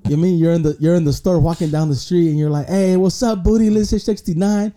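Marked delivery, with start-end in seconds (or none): 2.7-4.7 excited call-out. Retold or imitated. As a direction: greet her like a starstruck fan, half laughing. Calling out to online friend.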